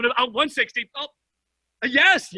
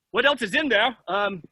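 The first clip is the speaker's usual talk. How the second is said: normal talking voice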